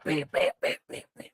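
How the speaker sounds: breathy whispered bam bam bam; soft mock impacts of a shaking house; imitated banging. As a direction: tap out the shaking under your breath.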